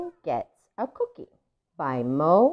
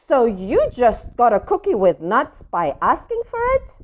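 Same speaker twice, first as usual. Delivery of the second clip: louder, disbelieving pigeon question; unconvinced pigeon; 5 semitones higher, 5 dB louder than usual. Acted. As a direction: challenge the duckling in disbelief. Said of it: "not convinced at all".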